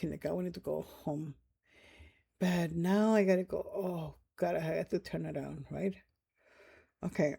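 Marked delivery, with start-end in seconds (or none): none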